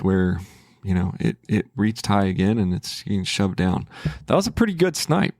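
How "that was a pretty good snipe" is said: pitched way up, pleased with the win; excited. Real, not acted. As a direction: crow about the good trade. Pleased with his winning trade.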